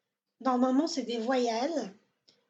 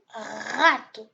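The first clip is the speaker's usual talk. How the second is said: râteau very slow, RA drawn out, weak ending; stress on RA; 2 semitones lower, 5 dB louder than usual. Shown as a demonstration. Teacher models Portuguese rato, heavy stress on RA. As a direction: stretch the first syllable of râteau. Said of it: "On donne l'accent".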